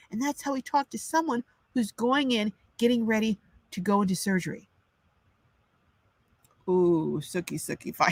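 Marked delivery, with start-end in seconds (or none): none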